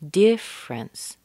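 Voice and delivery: "Difference" as DIFF-rence, high first syllable; missing syllable. Shown as a demonstration. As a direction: skip the middle vowel in "Difference". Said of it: "we're missing a syllable here".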